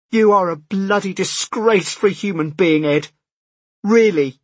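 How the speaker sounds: furious, tearful scolding; angry. Acted. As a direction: spit it out in disgust. Sister's tearful angry phone call.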